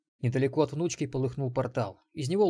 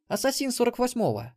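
high, matter-of-fact female boast; boastful assassin woman; 12 semitones higher, 3 dB louder than usual. A narrator's reading. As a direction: state your level proudly in a high voice. Мелисса boasts of her level. (высоким женским голосом, хвастливое утверждение)

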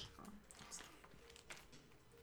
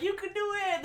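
mock encouraging hero; 10 semitones higher, 6 dB louder, faster than usual. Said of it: "all he does is be like".

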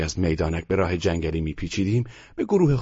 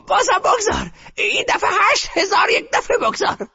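grandmother shouting. A narrator's reading. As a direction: shout down all objection. Grandmother orders more.

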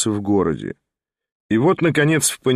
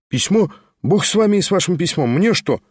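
higher, quick exclamation brushing it off; exclaiming; 5 semitones higher than usual. A narrator's reading. The guest brushing off the letter, exclaiming higher and fast. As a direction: toss the letter aside with a fast cry. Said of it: "воскликнул гость".

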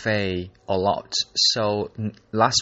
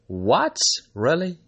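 surprised questions, higher on the second; surprised reaction; 9 semitones higher, 4 dB louder than usual. Retold or imitated. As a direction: retell a moment of disbelief, pitch jumping up. Reacting to friend's accent remark.

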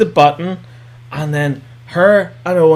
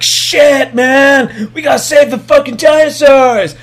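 higher, louder, urgent; urgent shout; 8 semitones higher, 4 dB louder than usual. Retold or imitated. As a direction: sound the alarm to save the dinosaurs. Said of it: "Bryce Dallas Howard is like".

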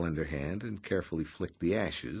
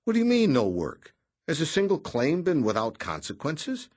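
higher, louder indignant protest; protesting Noonan; 9 semitones higher, 6 dB louder than usual. A narrator's reading. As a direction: object with mock outrage.

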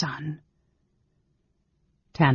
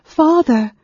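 much higher and louder, ragged with joy and longing; ragged joyful cry; 13 semitones higher, 9 dB louder than usual. A narrator's reading. Son Gilthas cries out to his long-lost father, loud and ragged with joy. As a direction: cry out to him, joy cracking through. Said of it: "stronger, with a ragged edge of joy and longing".

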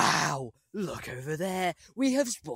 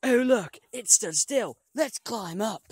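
excited; 7 semitones higher, 4 dB louder than usual. Pretend. Giraffe suddenly stands still.

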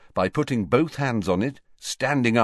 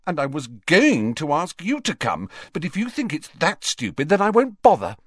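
rising hurt anger; 7 semitones higher than usual. A narrator's reading. Hermione snapping at Ron, building to anger. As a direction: flare up at the end, stung.

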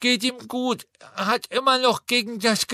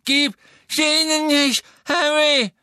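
much higher, louder frantic cry; Neville bellowing; 6 dB louder than usual. A narrator's reading. Neville pleading with Harry, frantic cry. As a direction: beg him beside yourself. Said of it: "offenbar außer sich".

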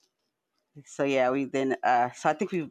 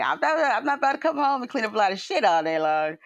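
higher, put-on voice of refusal; fed-up refusal; 8 semitones higher than usual. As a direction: act out a flat refusal to clean up. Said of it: "I'm like".

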